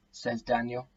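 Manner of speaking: plain reading voice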